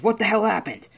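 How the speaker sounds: high, loud, startled outburst; shocked demand. Acted. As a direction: demand an explanation in alarm. Villain reacts to injured ally's return.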